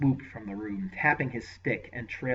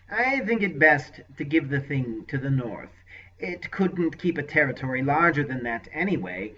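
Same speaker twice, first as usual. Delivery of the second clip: raised, lightly oily sorcerer voice; voiced sorcerer; 4 semitones higher, 8 dB louder than usual. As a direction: play the scheming sorcerer, mild. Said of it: "Rabadath faltered".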